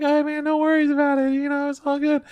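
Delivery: way up high, breezy and chirpy; high mock cheerful voice. Brushing off the apology, in a high chirpy voice. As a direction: squeak out an easygoing reassurance. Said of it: "And I'm like".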